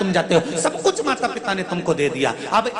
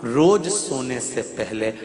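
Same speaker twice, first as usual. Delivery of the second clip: gentle sincere counsel; 8 semitones lower, slower than usual. Acted. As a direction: advise them tenderly, lower. Lower, soft preaching run. (धीमे, कोमल स्वर में सच्ची सलाह)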